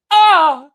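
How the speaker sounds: high loud mock scream; mock off-screen yell. A host mocking the actor's off-screen yell, hammed-up scream. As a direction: yelp like a bad actor off screen. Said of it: "yells from off screen".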